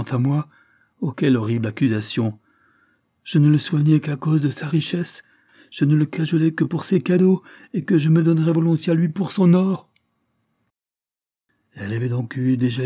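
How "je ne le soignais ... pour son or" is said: rising, louder indignant outburst. Acted. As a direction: hurl back the ugly accusation. Lilia repeating sisters' accusation. (indignation montante, de plus en plus forte)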